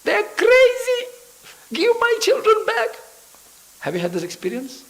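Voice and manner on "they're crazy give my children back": very high, loud, slow shriek of a frantic mother; screaming mother. Retold or imitated. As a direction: screech at him as the frantic mother. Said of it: "she was screaming at Prabhupada".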